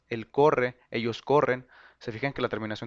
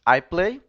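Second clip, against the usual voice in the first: I play said louder and higher; the example I play, louder and higher; clear model phrase; 5 semitones higher, 7 dB louder than usual. Shown as a demonstration. Teacher modelling the present tense example. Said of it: "yo digo".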